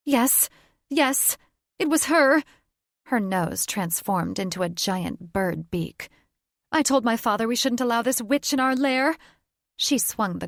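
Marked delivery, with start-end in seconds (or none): 0.1-2.4 higher and louder, a frantic accusing woman; shrill higher Catherine voice. Acted. Catherine accuses the witch, shrill and frantic. 6.7-9.2 higher, louder frantic woman; shrill Catherine. Acted. Catherine rants to Thaddeus about the witch, shrill.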